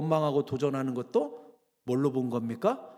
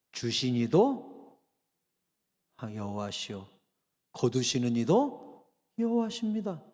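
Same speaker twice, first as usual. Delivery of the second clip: slow reverent quote; 5 semitones lower, slower than usual. Retold or imitated. Quoting Job's surrender.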